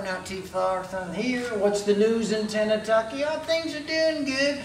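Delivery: ordinary talk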